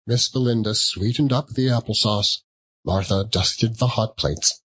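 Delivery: usual speaking voice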